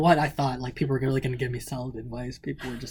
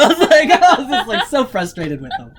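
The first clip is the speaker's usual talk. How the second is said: laughing; 10 semitones higher, 13 dB louder than usual. Real, not acted. Laughing while retelling frustration.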